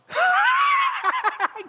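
very high, loud cackle; piercing acted guffaw; mock uproarious laughter. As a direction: burst into hammed-up shrieking laughter. Angels react uproariously to David.

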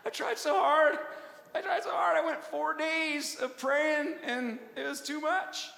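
high, loud, laughing self-pity; mock the quitter with a whiny laugh; mocking whine. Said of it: "I'm mocking".

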